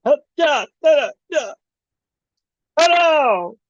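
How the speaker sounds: high, yelping bursts into a wail; mock panicked flailing. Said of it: "He's like".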